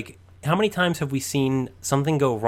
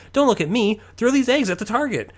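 high-pitched mock yell, building louder; 11 semitones higher, 4 dB louder than usual. Eddie barking orders at Johnny during a scam, mock yelling. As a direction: imitate a frantic yell, rising in pitch. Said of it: "Eddie will just start yelling at Johnny".

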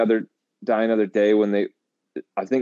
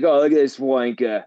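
put-on rough English accent; 5 semitones higher, faster than usual. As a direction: sneer it like a hammed-up English lout. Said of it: "the more, like, hick English people".

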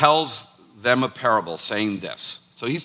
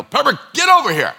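higher, louder call across the yard to Pepper; calling the dog; 11 semitones higher, 7 dB louder than usual. Retold or imitated. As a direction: yell for the dog to come back. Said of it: "I'll be like".

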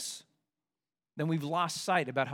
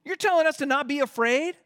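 much higher, alarmed, incredulous voice; panicked Israelite; 13 semitones higher, 4 dB louder than usual. Retold or imitated. Frightened Israelite tapping Moses' shoulder, high and incredulous. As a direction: squeak it out in disbelief. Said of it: "tapping Moses' shoulder".

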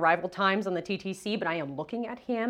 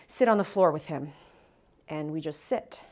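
ordinary talk

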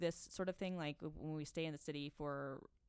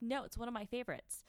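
higher bratty no; mock bratty refusal; 6 semitones higher than usual. Kylie refusing her sister the dress, lightly voiced petulance. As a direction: hold the dress back, a touch petulant. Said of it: "she's like".

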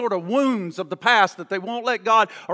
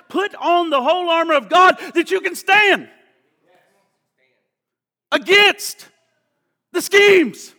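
reads the verse then climbs higher and louder; preaching scripture building to a shout; 9 semitones higher, 9 dB louder than usual. Acted. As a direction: build from steady reading to a pulpit cry.

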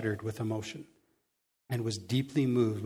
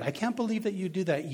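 nagging inner critic; 6 semitones higher than usual. Retold or imitated. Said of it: "You always got that voice".

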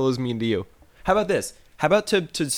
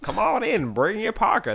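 put-on high, hammy old prospector; old-timey prospector voice; 9 semitones higher than usual. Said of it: "old-timey prospector voice".

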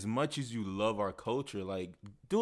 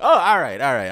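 country Jamaican uncle; 2 semitones higher, 10 dB louder than usual. Retold or imitated. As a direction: imitate a rural Jamaican uncle. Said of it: "I can repeat anything".

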